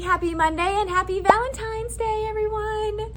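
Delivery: bright, high, excited hello; cheery greeting. Real, not acted. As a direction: greet viewers with big energy.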